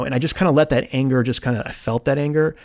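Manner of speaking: ordinary talk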